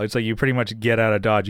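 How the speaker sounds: normal talking voice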